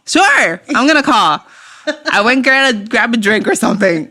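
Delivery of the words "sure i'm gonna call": higher, overdone mock assurance; emphatic stalling. Pressed by ex to call, stalling with emphatic assurance. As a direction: insist brightly while putting it off.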